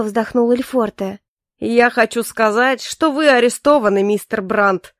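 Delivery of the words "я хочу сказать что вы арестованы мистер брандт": booming, triumphant declaration. Acted. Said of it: "зычным голосом произнёс Мэклбери".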